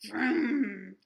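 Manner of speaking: loud engine vroom; truck vroom. A narrator's reading. Truck rushing in out of nowhere, loud engine vroom. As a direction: roar it like a big truck coming.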